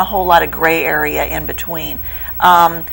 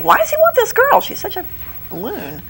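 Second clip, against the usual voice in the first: incredulous; 11 semitones higher, 4 dB quieter than usual. Retold or imitated. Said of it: "you're thinking".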